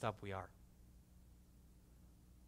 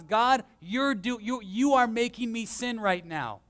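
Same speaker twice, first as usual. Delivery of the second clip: raised, louder pretend accusation, whining at God; hammed-up mock prayer of blame, higher and louder; mock whiny complaint to God; 6 semitones higher, 5 dB louder than usual. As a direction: play the sinner blaming God, half in jest. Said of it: "no one should say and blame God, says".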